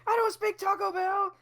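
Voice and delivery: a light take on the comedian's yelled line; quoting the comic. Retold or imitated. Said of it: "and the guy's".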